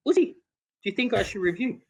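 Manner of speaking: high, pleading repeat after no reply; high pleading plea to a silent Uzi; pleading again. Retold or imitated. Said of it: "He did not say a word".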